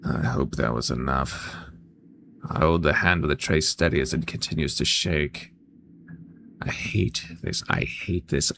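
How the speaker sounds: low, soft story narration; hushed inner monologue. Acted. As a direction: read it low and quiet, as private thoughts. Reads inner monologue of game character.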